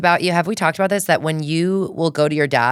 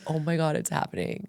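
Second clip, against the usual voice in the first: under-the-breath mock alarm; hushed aside; 10 dB quieter than usual. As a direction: drop to a near-whisper, as if to oneself. Retold dread of giggles coming.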